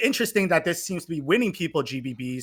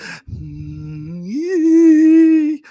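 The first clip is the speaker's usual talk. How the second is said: high mocking laugh burst; 9 semitones higher, 8 dB louder than usual. Ranting to friends, a high mocking laugh. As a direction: laugh at the lazy trick.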